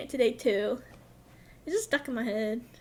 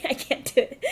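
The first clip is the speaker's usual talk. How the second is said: normal talking voice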